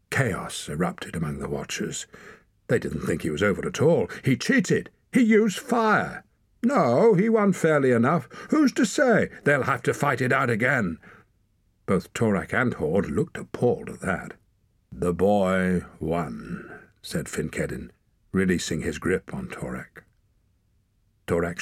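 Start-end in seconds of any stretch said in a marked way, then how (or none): 4.2-11.0 high-pitched clamour of arguing onlookers; heated outcry. A narrator's reading. Watchers argue in chaos after a fight, heated high outcry. Watchers erupt in chaotic argument. 14.9-16.8 slow, deliberate pronouncement; measured verdict. A narrator's reading. Leader rules on the fight, slow measured verdict.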